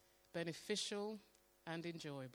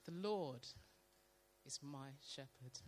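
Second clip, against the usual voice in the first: slow, fading reading; 3 semitones lower, 5 dB quieter, slower than usual. Shown as a demonstration. Reading scripture slowly aloud to the church, softly. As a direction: read slowly, letting it sink in. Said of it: "read it very slowly".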